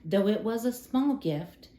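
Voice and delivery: plain reading voice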